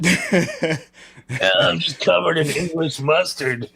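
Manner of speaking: high put-on voice breaking into laughter; laughing mock line. Mocking the British slang to his co-host while laughing. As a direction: giggle through the joke.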